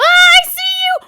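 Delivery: high, loud, slow yell of discovery; triumphant shout. Real, not acted. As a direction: stretch it out, gotcha. Spots the hidden player again.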